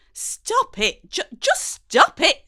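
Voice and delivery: high, loud pleading cry. A narrator's reading. Mum yelling at her daughter to stop, high and loud. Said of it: "she cries".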